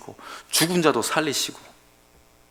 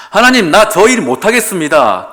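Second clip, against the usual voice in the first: loud, higher whining excuse; pleading excuse; 7 semitones higher, 11 dB louder than usual. Retold or imitated. Moses whines to God, loud and high. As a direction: complain that you can't. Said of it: "하나님께서 말씀하시죠".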